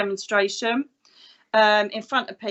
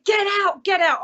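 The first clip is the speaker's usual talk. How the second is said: high-pitched mock order; imitated exasperated partner; 9 semitones higher than usual. Police officer partner to spirits, high exasperated command. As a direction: play the partner shooing spirits away.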